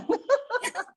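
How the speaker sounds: a sudden high laugh. Real, not acted. Laughing as she tells the host about the gesture. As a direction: break into laughter. Laughs after retold insult.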